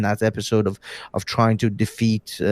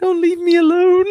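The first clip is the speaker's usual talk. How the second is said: much higher, louder whine; mock desperate plea; 4 dB louder than usual. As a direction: plead like a lost child. Mock abandonment.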